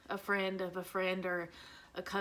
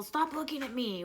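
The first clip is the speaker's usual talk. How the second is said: higher-pitched mock yell of a child; half-voiced yell, pitched up; imitated yell; 6 semitones higher than usual. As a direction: mimic a kid yelling at strangers, not at full volume. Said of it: "he's yelling at people".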